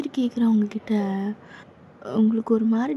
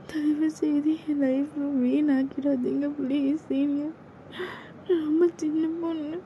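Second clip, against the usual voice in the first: put-on desperate pleading girl; 4 semitones higher, 5 dB quieter than usual. Junior begging her senior to spare her, high and desperate. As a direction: beg for her life not to be ruined.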